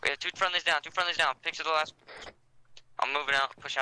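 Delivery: high urgent casualty callout; shouting. Real, not acted. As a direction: call it out over gunfire. Calling out casualties in combat.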